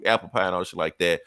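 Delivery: normal talking voice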